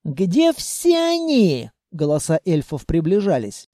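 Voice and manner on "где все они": high, drawn-out call in an elf voice; searching elf. A narrator's reading. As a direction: call out slowly to the others. Elves call out while searching. (высокий протяжный оклик эльфа)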